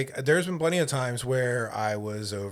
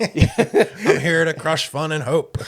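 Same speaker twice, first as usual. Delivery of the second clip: loud, slow, higher-pitched killjoy proclamation; mock fun-crusher; 5 semitones higher, 9 dB louder, much slower than usual. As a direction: declare it like a hammed-up villain, laughing. Joins in as grumpy old man.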